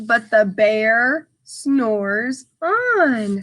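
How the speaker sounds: very slow, drawn-out storybook line; slow sleepy refrain. Acted. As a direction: stretch it out like the bear is snoozing. Sleepy refrain read to children.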